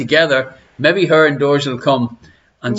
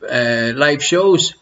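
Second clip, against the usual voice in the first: ordinary talk, nothing marked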